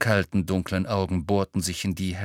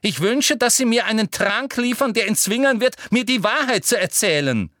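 much higher, louder call; angry shout; 11 semitones higher, 5 dB louder than usual. A narrator's reading. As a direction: demand furiously. Umbridge trembling with rage. (zornig gerufen)

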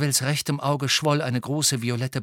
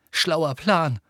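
sarcastic jab, pitched up; angry snap; 6 semitones higher, 4 dB louder than usual. A narrator's reading. Harry venting his rage at Hermine, angry snap. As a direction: snap it out in irritation. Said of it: "fauchte er Hermine an".

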